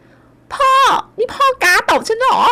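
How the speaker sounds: very high, slow stunned cry; shocked wail. A narrator's reading. Slapped daughter crying out at her father in shock. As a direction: disbelief after a slap.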